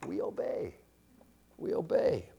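very soft, pausing between repeats; hushed reverence. Real, not acted. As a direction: let it sink in, barely voiced.

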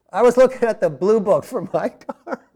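words breaking into laughter; chuckling at his own cheap car; laughing. Real, not acted. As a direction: laugh through the line.

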